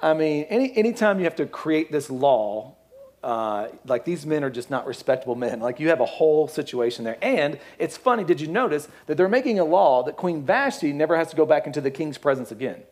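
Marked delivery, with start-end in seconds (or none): none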